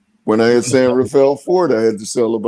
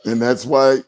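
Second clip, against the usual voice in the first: ordinary talk, nothing marked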